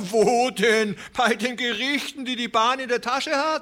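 a farmer scoffing at the courts; höhnischer alter Mann. A narrator's reading. Scornful old man voiced. (viel höher, spöttisch)

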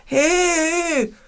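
high, outraged yelp; indignant protest. Acted. Bear protests as the letter is pushed on, indignant. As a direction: protest sharply at being skipped. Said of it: "empört sich der Bär".

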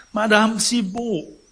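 high, slow loud call; anxious call. Acted. Sick Pons calls the concierge back, high and slow.

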